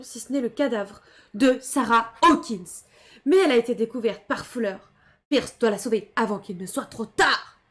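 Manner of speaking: storyteller reading a recap, steady; dramatic narration. Acted. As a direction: read it like a game narrator. Reads game recap dramatically.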